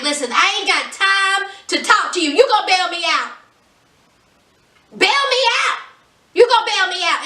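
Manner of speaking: high, insistent mock demand. Jailed man ordering his partner to bail him out, demanding. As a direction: imitate someone pushing hard to be bailed out.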